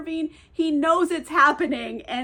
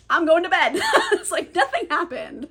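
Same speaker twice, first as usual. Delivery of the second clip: laughing, louder and higher; 5 semitones higher, 5 dB louder than usual. Real, not acted. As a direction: laugh through the words. Laughs while telling.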